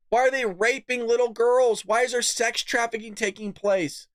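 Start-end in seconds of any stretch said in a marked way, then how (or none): none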